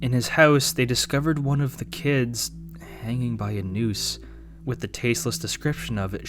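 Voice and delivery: usual speaking voice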